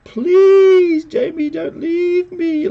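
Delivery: loud, high, drawn-out mock plea; slow, shrieky imitation of desperate begging. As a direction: wail like an abandoned wife, hammed up. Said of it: "So here she's begging".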